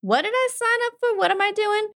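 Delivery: high, confused squeak of disbelief; suddenly lost and overwhelmed; bewildered panic. Retold or imitated. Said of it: "it's gonna be like".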